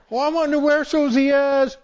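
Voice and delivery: playing hide and seek with Susie, high mock puzzled voice; mock puzzled seeker. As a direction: ham up not seeing the child. Seeker pretends not to see Susie.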